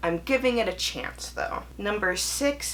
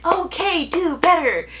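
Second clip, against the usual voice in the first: put-on high, louder patronizing voice to a child; condescending adult; 12 semitones higher, 6 dB louder than usual. Booktuber mocking books that talk down, high patronizing voice. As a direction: talk down like to a five-year-old. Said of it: "talking to you like you're a five-year-old".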